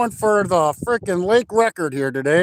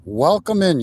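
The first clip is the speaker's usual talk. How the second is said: deep announcer intro; 7 semitones lower than usual. Acted. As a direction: open the show like a deep-voiced outdoors host. Deep show-intro announcer opening.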